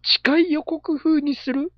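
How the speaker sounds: high, slow disbelieving question; incredulous echo. Real, not acted. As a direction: repeat it back in disbelief. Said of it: "なんでそんなハードルを上げるスタイルなん？".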